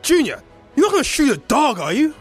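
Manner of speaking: loud, high-pitched outcry; alarmed protest. Acted. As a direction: cry out to stop him. Pleading not to shoot the dog.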